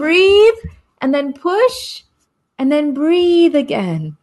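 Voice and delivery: slow, paced midwife instruction; slow midwife coaching. Retold or imitated. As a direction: coach it gently, step by step. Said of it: "No, she says".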